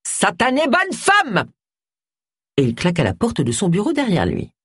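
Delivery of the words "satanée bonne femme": high, drawn-out exasperated cry; angry outburst. A narrator's reading. Vicar cursing Agatha from his office, angry outburst. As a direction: let it ring out slowly through the house. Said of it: "retentit la voix du vicaire".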